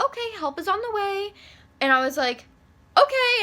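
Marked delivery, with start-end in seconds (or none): 0.0-1.3 high reassuring operator; chirpy operator promising help. Retold or imitated. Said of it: "she's like". 2.9-3.4 forced chirp. Retold or imitated. Reply to operator.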